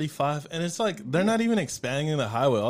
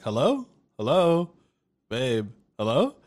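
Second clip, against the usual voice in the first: calling out; 3 dB louder, slower than usual. Retold or imitated. Calling girlfriend after crash sound.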